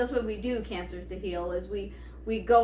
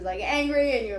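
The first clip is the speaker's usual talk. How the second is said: ordinary talk, nothing marked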